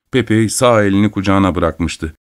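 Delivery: plain reading voice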